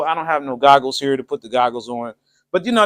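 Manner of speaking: ordinary talk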